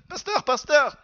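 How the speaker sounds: high, excited call for the pastor; enthusiastic student. Retold or imitated. Student rushing to tell his pastor at the end, enthusiastic. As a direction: call out eagerly, voice jumping up. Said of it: "il était tout enthousiaste".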